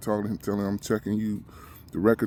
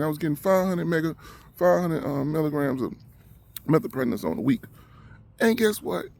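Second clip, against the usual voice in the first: usual speaking voice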